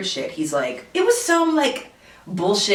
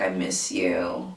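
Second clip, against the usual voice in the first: much lower, softer and slow, a mopey guy; mocking the ex; 11 semitones lower, 6 dB quieter, much slower than usual. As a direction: drag it out low like a sad sack. Mocking ex's text message.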